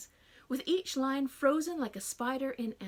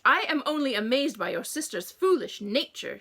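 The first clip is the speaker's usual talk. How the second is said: loud wailing complaint; fussy mother lamenting; 2 semitones higher, 8 dB louder than usual. A narrator's reading. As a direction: wail it at Harriet. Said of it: "she wailed in my direction".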